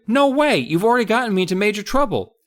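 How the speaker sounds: narrator refusing. Acted. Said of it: "I cried".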